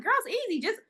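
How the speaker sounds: put-on high, breezy dismissive girlfriend; a light take on the girlfriend. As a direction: toss it off like it's nothing.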